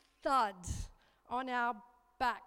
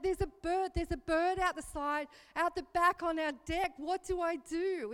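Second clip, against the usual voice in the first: high, loud frantic plea for help; begging son what to do, in a panic; panicked; 5 semitones higher, 6 dB louder than usual. Retold or imitated. Mother tells son about the bird, high and frantic. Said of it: "there's panic in my voice".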